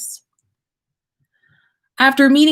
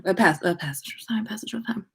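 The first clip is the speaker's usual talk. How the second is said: quick whispered aside to the listener; 2 semitones higher, 7 dB quieter, faster than usual. Real, not acted. Reader whispering a quick aside, hushed. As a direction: drop to a whisper for the time skip. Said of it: "aside marking time skip".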